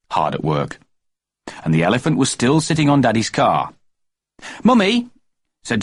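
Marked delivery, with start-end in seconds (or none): 4.6-5.1 put-on high loud call of a boy; excited child call. A narrator's reading. Child calls out loudly.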